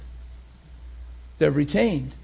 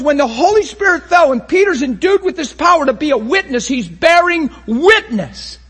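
loud, high, impassioned preaching; full-force preaching; 7 semitones higher, 6 dB louder than usual. Acted. Preacher to his congregation, impassioned full-force preaching. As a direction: preach it out to the whole room with fire.

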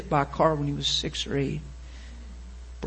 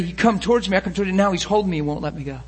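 ordinary talk, nothing marked

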